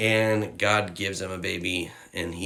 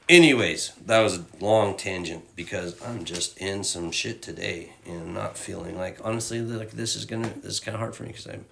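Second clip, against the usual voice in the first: ordinary talk, nothing marked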